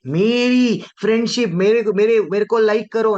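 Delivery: mock whining plea. Mocking fame-seeker, rising.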